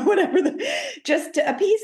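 high, laughing through the words. Real, not acted. Podcast guest joking about eating to please someone, laughing. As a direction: let a chuckle run through the aside.

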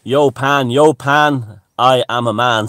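booming, high mock-god proclamation; loud and lofty, voicing the god Pan; great god Pan. A vlogger hamming up the god Pan's boast, loud and high. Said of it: "said the great god Pan".

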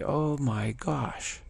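drawn-out "oh my gosh" in wonder; slow awed gasp. Retold or imitated. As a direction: let the realization dawn slowly. Retold realization.

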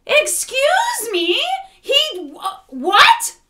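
high, slow disbelieving excuse me; incredulous. Retold or imitated. Reacting to the movie's twist, high and slow disbelief. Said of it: "And I was like".

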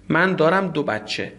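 ordinary talk, nothing marked

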